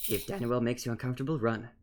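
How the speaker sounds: soft whispered aside. Acted. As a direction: whisper it into his ear. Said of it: "I'm gonna whisper into his ear".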